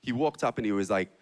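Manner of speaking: normal talking voice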